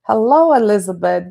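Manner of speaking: ordinary talk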